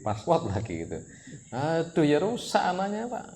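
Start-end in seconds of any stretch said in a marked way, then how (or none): 1.5-3.2 sudden high alarmed cry of dismay; alarmed aduh. Real, not acted. Preacher alarmed at careless parents, high dismayed cry. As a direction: throw up your hands in alarm.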